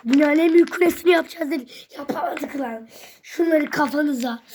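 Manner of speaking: loud fast exasperated rant; frustrated outburst. Real, not acted. Failed tower again, kid rants loud and fast. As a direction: blurt it out in frustration.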